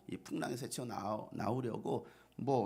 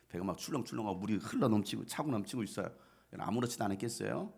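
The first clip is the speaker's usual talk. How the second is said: ordinary talk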